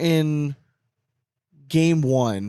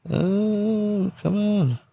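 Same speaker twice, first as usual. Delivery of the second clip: higher, drawn-out sigh of frustration; real annoyance at the lag; exasperated; 7 semitones higher, much slower than usual. Real, not acted. Host groaning to co-host about laggy internet, exasperated.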